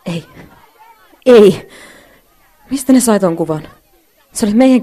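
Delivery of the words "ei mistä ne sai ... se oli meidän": loud, anguished cry of disbelief; shocked outcry. Acted. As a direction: cry out as the photo appears. Sees the kiss photo shown. (järkyttynyt huuto)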